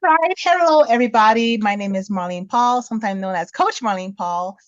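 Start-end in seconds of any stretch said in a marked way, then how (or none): none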